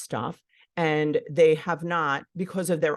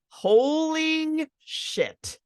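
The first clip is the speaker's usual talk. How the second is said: long, high drawn-out "holy"; stunned realization; 11 semitones higher, much slower than usual. Acted. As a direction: stretch it out in mock amazement. Voices a parent's stunned admission.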